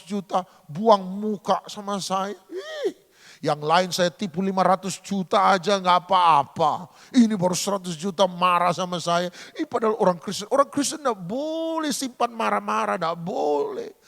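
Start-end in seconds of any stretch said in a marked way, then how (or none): none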